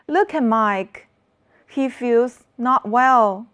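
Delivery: ordinary talk, nothing marked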